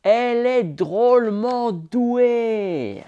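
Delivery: high, slow admiring voice; proud father. A narrator's reading. Father admiring Poco's drumming, slow and proud. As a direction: savour each word with pride.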